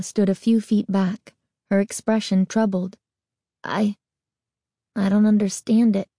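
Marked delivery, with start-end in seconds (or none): none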